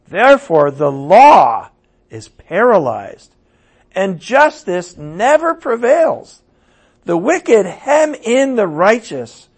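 full-force sermon reading of the lament; preaching at full force. Acted. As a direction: hammer each complaint home. Habakkuk's complaint, read with force.

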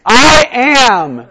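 high, loud, stretched declaration; authoritative proclamation. Acted. Leader echoing the volunteer's line, loud proclamation. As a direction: proclaim both words big.

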